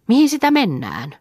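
put-on child's question; high child voice. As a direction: ask like an eager small girl. Child asks in boat.

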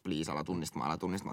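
soft, pleading under the breath; inner plea. Retold or imitated. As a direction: beg silently not to be recognized. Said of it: "Mä oon, että".

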